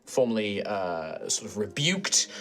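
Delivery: measured storyteller recap; story recap narration. A narrator's reading. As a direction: narrate the recap over music. Recap narration over music.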